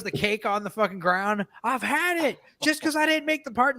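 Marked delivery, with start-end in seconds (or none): 1.6-3.8 mock tantrum.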